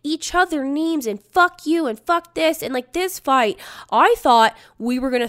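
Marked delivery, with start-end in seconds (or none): 1.2-1.9 high, loud retold insult; yelling. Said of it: "The yelling continues". 2.0-2.6 raised retold outburst; yelling. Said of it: "The yelling continues".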